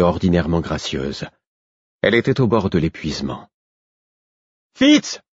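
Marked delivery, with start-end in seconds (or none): 4.8-5.2 high-pitched cry of alarm; desperate shout for help; frightened cry. A narrator's reading. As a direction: call out his name in dread. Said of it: "cria-t-elle encore, et je perçus de l'effroi dans sa voix".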